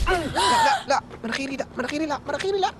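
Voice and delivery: high, frantic no's about his nose; high frantic pleading; panicked protest. Acted. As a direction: squeal in panic to save your nose. His nose is being squashed.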